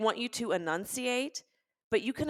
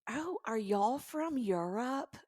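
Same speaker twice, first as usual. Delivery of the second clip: high, drawn-out waitress question; slow, higher-pitched mimicry of a puzzled stranger; imitated waitress; 6 semitones higher, 3 dB quieter, slower than usual. Waitress asking a mother about her child's accent, imitated high and slow. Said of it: "the waitress looks at me and goes".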